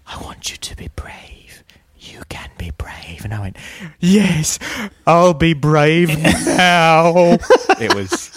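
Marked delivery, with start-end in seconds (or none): 0.1-3.2 whisper. Retold or imitated. Retelling an ASMR video to a friend, whispered. 4.0-7.4 put-on high, loud, slow childlike yes; mock eager reply. Mocking his own reply to the video, high and loud.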